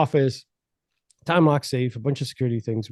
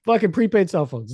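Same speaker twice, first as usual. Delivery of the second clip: mock outrage; 9 semitones higher than usual. Mocking outraged reaction.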